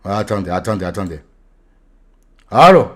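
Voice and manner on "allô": high, loud allô into the void; loud hello call. Real, not acted. Streamer calling to chat that went silent, loud. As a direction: check if anyone's there.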